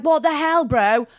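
high, loud disbelief; loud, high-pitched mock alarm; shocked exclamation. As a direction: re-enact being stunned and indignant. Acting out shock at bank shutters.

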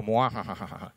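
low, quiet evil cackle; mad scientist laugh. Pretend. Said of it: "risa de científico loco".

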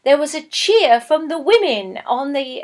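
ordinary delivery

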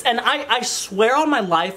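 ordinary talk, nothing marked

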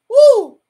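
high, loud release after tears; loud whoop of release. Real, not acted. Daughter shakes off her grief for mother, loud whoop. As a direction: let out a loud woo to shake off the feeling. Catching breath after emotional moment.

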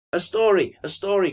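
put-on high, eager children's call; children crying out. A narrator's reading. Children begging the fat man for a story, crying out. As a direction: pitch it up like clamouring children. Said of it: "cried the children".

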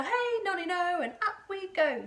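put-on high, loud sing-out from the old lady; jubilant shout. A narrator's reading. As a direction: whoop it out merrily. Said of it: "she shouted".

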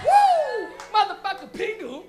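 high, loud whoop; cheer. Real, not acted. Comic cheering the cartoon Pingu with a high loud whoop. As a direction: whoop with glee. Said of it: "cheering Pingu".

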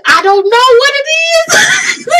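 loud, high, slow, tearful shout; panicked wail. Retold or imitated. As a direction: cry it out in helpless mock panic. Acts out panic at forgetting name.

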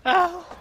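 sharp high yelp of pain. Real, not acted. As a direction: yelp out suddenly. Sudden pain in game.

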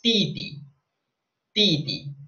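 "titi" said slowly in Mandarin tones; Chinese word. Shown as a demonstration. Teacher teaching the word for younger brother. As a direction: model "titi" carefully. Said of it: "decimos titi".

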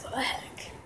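hushed shocked whisper; startled whisper. Real, not acted. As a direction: whisper it, caught off guard. Startled by something in yard.

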